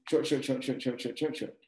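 rapid, even run of "short"; quick short beats. Shown as a demonstration. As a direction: rattle off each "short" as a single beat. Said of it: "a short syllable lasts one beat of time".

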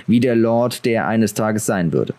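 ordinary delivery

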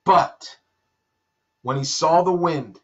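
ordinary talk, nothing marked

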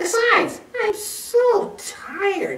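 very high, slow, drawn-out squeak; exhausted tiny bat; squeaking tired bat. A narrator's reading. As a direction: squeak it wearily, stretching the words. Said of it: "squeaked Bat".

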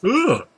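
high, loud yawn; real yawn. Real, not acted. Gamer caught yawning on camera without being tired, a big yawn. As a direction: let out an involuntary yawn. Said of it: "I don't know why I'm yawning".

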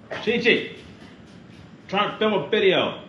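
loud, high-pitched call to quit it; real scolding call. Real, not acted. Host to Chi Chi while filming, scolding call. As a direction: call out, annoyed at the interruption.

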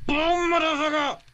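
loud high gloating yell; triumphant shout. Real, not acted. Launching friend in game.

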